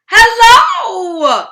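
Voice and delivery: a bright, high, loud call of hello; excited hello. Acted. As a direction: greet the kids with a big cheerful shout. Excited greeting to young listeners.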